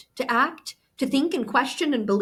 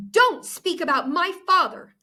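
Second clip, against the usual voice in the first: sharp, raised snap with a hit on Don't; snapping; 8 semitones higher, 4 dB louder than usual. A narrator's reading. Girl snaps at her friend to defend her father. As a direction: bite back hard on Don't. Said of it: "I snapped".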